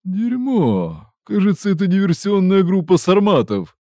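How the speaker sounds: high, loud alarmed curse; alarmed exclamation. Acted. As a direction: shout it out in sudden alarm. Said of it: "воскликнул тот".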